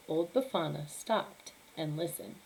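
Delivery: plain reading voice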